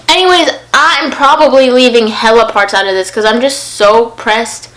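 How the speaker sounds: ordinary delivery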